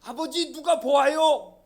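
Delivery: high, drawn-out loud call; shouting. Acted. Son calls to father in the field, shouting. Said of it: "아들이 소리를 칩니다".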